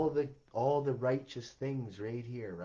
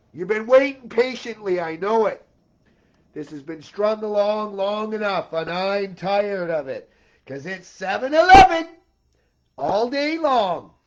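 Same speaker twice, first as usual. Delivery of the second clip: higher, louder frustrated declaring; loud ranting; 6 semitones higher, 11 dB louder than usual. Real, not acted. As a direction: lay it on them, fed up and loud. Ongoing shouted rant.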